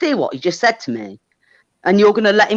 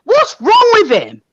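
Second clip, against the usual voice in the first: much higher, louder and slow, outraged; furious shout; 7 semitones higher, 4 dB louder, slower than usual. Real, not acted. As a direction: bellow at the room in disbelief, slowly. Shouting during heated argument.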